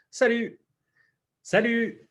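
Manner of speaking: high, clear "Salut" with rounded u; "Salut" said slowly for students to repeat; model pronunciation. Shown as a demonstration. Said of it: "read it for y'all so you can practice pronunciation".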